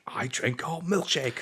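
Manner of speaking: high, slow film-villain impression; Daniel's milkshake voice. Shown as a demonstration. Showing how they used to imitate Daniel, gloating. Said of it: "I used to go like".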